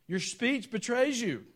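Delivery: high, loud, slow accusation; slowly accusing Peter of his accent. Retold or imitated. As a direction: point the finger with every word. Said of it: "This is what they tell Peter".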